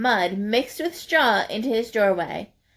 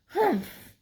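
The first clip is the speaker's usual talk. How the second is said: 1 grumpy humph; 8 semitones higher, 4 dB quieter than usual. Acted. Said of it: "he said again".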